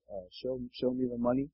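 a shy, unwilling first try; reluctant, quiet. Retold or imitated. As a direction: say it half-heartedly, holding back. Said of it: "at first he reluctantly says".

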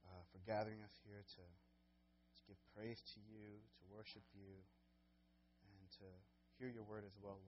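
quiet pastor's prayer before preaching; earnest prayer. Acted. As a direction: pray softly to open the sermon. Leads an opening prayer.

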